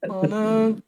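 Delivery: high, half-laughing oh no; mock unbothered dismay. Ghoul caught out, hammed-up high oh no. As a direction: say it like you barely care. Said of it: "And he goes".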